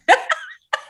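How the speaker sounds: airy laugh bursting out; breathy high laugh. Real, not acted. Laughs at "under his eye.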